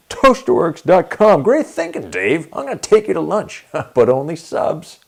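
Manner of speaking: the smug go-getter hamming it up for the camera; cocky boss bluster. Retold or imitated. Cocky boss Dean hijacks scene.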